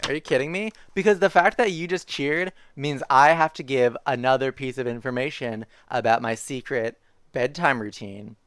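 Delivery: ordinary delivery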